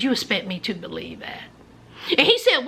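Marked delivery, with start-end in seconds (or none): 0.0-1.4 low, quick and quiet; deadpan challenge. Retold or imitated. Girlfriend doubts boyfriend's excuse, quiet deadpan challenge. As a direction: drop low and soft to call out the lie.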